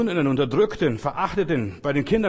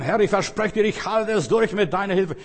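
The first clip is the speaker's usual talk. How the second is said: raised, louder pledge to God; earnest vow; 6 semitones higher, 5 dB louder than usual. Acted. Believer promising God to hold out, earnest vow. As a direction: vow it in a lifted voice.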